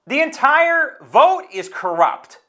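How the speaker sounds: high, slow, deliberate outrage; emphatic denunciation. Real, not acted. Host denounces a corrupt county party vote, indignant and emphatic.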